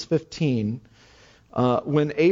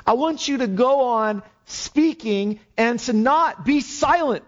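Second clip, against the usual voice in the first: rally him to keep speaking; fervent urging; 6 semitones higher than usual. Acted. The Lord charging Paul not to fall silent, fervently.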